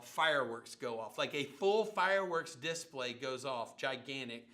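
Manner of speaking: normal talking voice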